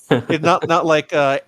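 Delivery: ordinary talk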